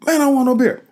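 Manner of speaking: high, loud angry shout refusing the beer; angry refusal. Retold or imitated. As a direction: snap it back, furious. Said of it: "I keep going off".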